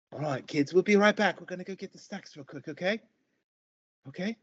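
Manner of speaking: high, loud bustling mother; cheery otter mom. Acted. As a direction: round everyone up with forced cheer. GM voices the mother.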